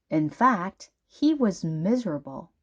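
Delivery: plain reading voice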